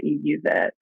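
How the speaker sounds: ordinary talk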